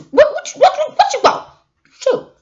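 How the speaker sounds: shrill-free, high-pitched dismissive what what what; snippy brush-off. Pretend. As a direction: play the rude friend snapping back. Acting out rude brush-off.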